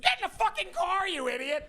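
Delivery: very high, screeching mock yell; imitating her, full-volume shrieked order; furious fiancée yell. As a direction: scream it way up high like an exasperated fiancée. Acted screaming fiancée.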